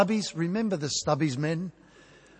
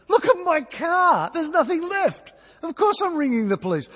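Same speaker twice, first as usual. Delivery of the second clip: upset protest; 10 semitones higher, 3 dB louder than usual. Retold or imitated. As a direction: throw it at the driver, outraged. Confronting truck driver after crash.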